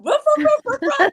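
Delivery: loud, very high pretend ruffs; mock dog barking. Mother barking to amuse the baby, high loud mock barks. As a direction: bark playfully like a small dog. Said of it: "mommy barks too".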